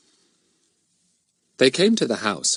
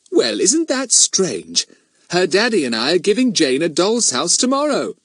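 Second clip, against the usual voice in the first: high, pleasantly surprised woman; Missus Thomas, pleased mother; 9 semitones higher, 3 dB louder than usual. A narrator's reading. Mother tells two visiting girls about the birthday present, pleased surprise. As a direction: marvel at a happy coincidence.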